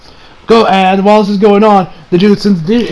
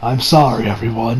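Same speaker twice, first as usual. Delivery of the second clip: lower, softer sorry with eyes closed; quiet apology; 9 semitones lower, 7 dB quieter than usual. Retold or imitated. Tien apologizing to his team, soft and low. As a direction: hang your head and murmur it. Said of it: "be like".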